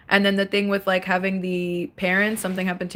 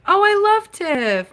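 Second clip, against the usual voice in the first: excited gush; 11 semitones higher, 5 dB louder, slower than usual. Real, not acted. Gushing over a favorite creator.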